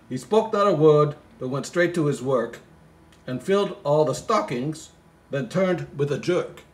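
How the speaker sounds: poem reading. Acted. Comic reader reciting the Christmas poem, lightly sing-song. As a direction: recite the verse with a touch of storybook lilt.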